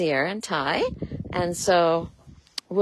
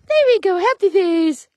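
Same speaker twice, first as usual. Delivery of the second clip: high, louder burst of praise; excited praise; 10 semitones higher, 10 dB louder than usual. Real, not acted. Owner praising the filly, high excited cheer. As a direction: cheer her on brightly.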